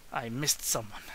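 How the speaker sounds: ordinary talk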